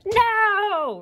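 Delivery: very high, drawn-out cartoon scream; mock scream. Artist voicing her comic character's big "no", mock scream. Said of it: "She's screaming it out there".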